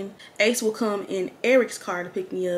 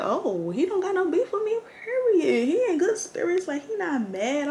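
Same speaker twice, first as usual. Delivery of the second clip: high, bright-eyed relief, much higher pitch; relieved excitement; 10 semitones higher than usual. Retold or imitated. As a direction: gush with relief that he isn't mad. Relieved inner thought retold.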